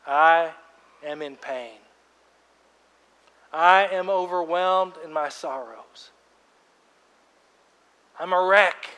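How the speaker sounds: loud, pained admission of sorrow; anguished confession. Acted. Pastor voicing a hurting believer, loud and raw. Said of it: "admit things stink".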